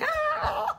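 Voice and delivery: high-pitched happy "ah"; demonstrated squeal through a smile; smiling squeal. Shown as a demonstration. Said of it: "I smile, okay? I'm like".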